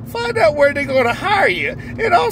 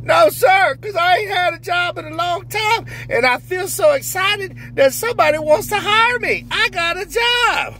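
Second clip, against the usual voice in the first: overjoyed new hire; 6 semitones higher than usual. Acted. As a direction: squeal it out like the happiest man alive. Character answers the interviewer.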